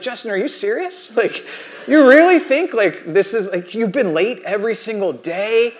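ordinary delivery

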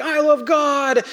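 high, drawn-out mock chant back; imitated kids' shouting. Kids on the other side shouting back, mock shout. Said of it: "they're shouting at each other".

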